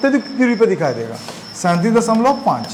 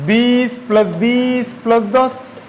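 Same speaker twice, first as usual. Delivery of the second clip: slow dictation; much slower than usual. Shown as a demonstration. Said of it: "slow dictation of sum".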